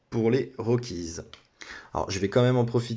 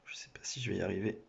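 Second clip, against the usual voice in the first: muttered aside; 11 dB quieter, faster than usual. Real, not acted. Struggling with phone, muttering aside.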